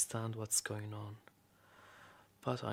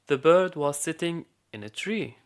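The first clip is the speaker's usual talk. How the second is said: louder, higher fairy-tale delivery; storytelling to a child; 4 semitones higher, 11 dB louder than usual. Acted. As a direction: project the tale to a small listener. Storyteller resumes tale for boy.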